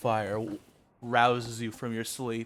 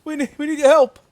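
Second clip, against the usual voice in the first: high, loud, panicked plea; urgent soldier; 12 semitones higher, 10 dB louder, faster than usual. Acted. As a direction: beg for help over gunfire. NPC soldier pleading, urgent.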